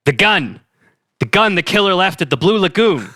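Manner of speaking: put-on intense detective with a Boston accent; urgent Boston cop voice. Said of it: "my killer Boston accent".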